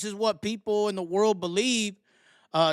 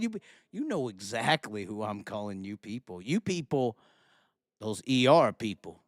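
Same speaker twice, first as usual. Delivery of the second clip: low sinister mock voice; 10 semitones lower, 5 dB quieter than usual.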